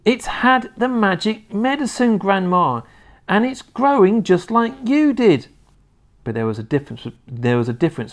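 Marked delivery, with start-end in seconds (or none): 0.0-5.5 high, eager calling; lightly shouted boyish excitement; excited boyish shouting. A narrator's reading. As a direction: tell Grandma excitedly at a raised pitch. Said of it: "with excitement pointing at the enormous hen and shouting".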